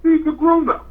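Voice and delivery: high-pitched imitation of a baffled kid; incredulous child. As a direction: mimic a child questioning the adults. Voicing the grandson judging adults.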